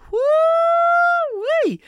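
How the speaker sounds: very high, loud drawn-out "woo-wee"; excited whoop. Real, not acted. Host hyping up listeners at the top, excited high whoop. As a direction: let out a piercing, gleeful whoop.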